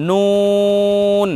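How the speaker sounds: নুন held long and slow; teacher modelling the letter slowly; drawn-out letter name. Shown as a demonstration. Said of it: "এইরকম আমরা উচ্চারণ করি".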